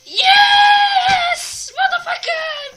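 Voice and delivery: very high, very loud triumphant yell; victory scream. Real, not acted. Gamer screaming in triumph after clearing the level. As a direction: explode with joy at the win.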